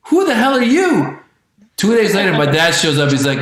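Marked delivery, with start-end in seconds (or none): 0.0-1.2 high-pitched, defiant dog voice; indignant talking dog. Acted. Dog rejecting its new young owner, high indignant refusal. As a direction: snub the stranger in a raised voice. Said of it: "And the dog's like".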